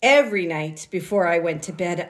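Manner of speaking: ordinary delivery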